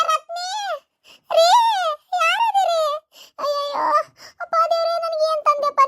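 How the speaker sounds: put-on high, crying old woman calling for help; wailing old mother-in-law. Mother-in-law cries out to the household for help, high wailing.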